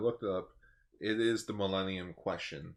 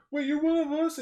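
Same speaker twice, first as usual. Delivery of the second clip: very high, loud amazed question; bright magical girl; 8 dB louder than usual. Retold or imitated. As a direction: squeal it in delighted shock. Riku quoted, shocked.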